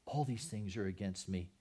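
"All these things are against me" said low and soft, defeated; quiet lament. Acted. Preacher echoing Jacob's grief, quiet and broken. The loss of another child.

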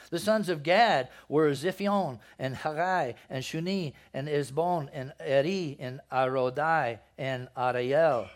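loud, deliberate reading of names; scripture reading. Acted. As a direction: read each name out clearly to the room. Reads genealogy list aloud.

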